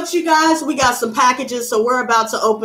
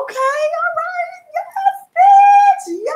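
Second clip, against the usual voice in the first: much higher squeal, loud on bitch; squealing glee; 4 dB louder, much slower than usual. Real, not acted. Admiring her ring, squealing yes in a high voice. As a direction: squeal with delight.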